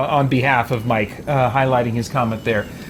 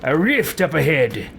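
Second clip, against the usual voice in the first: haughty self-regard; slower than usual. Acted. Haughty character admires own powers.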